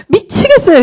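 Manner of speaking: mock exasperated student, pitched high; retold exasperation. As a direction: imitate a student at wits' end, high.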